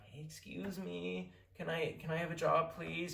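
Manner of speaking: small, high, hesitant plea; mock timid. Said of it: "hide out in the corner".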